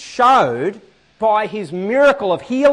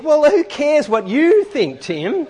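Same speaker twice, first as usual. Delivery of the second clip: mock indignant; 7 semitones higher, 4 dB louder, slower than usual. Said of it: "I sort of think".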